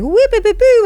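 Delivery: high-pitched playful "whoop boo boo"; zany cartoonish vocal flourish; bright, colorful whoops. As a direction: squeal out little high boops. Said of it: "so bright and colorful".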